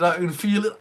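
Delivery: excited. Real, not acted. Repeats the phrase, building excitement.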